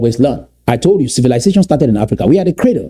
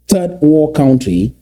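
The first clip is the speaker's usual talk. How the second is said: ordinary talk, nothing marked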